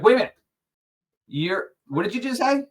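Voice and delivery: high, loud startled demand; excited challenge. Retold or imitated. Woman at the party confronting him, high and startled. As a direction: pounce on him with a sudden question.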